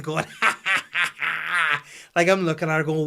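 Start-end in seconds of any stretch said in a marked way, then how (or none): 0.4-1.8 high, loud, gleeful staccato cackle; imitated baby laugh. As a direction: cackle like a delighted toddler. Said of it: "she's on her knees with a shit in each hand".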